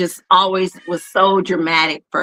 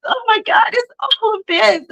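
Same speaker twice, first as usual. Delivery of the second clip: much higher, wailing drama-queen voice; mock melodramatic sobbing, much higher; dramatic crying girl. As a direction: overact the tears like a little drama queen. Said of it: "You out here being dramatic".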